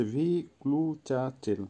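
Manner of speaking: ভিক্লু চাচেল softly, syllable by syllable; careful, spaced-out repeat of ভিক্লু চাচেল; model pronunciation of ভিক্লু চাচেল. Shown as a demonstration. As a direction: break ভিক্লু চাচেল into separate syllables for learners. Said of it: "আবারও বলছি".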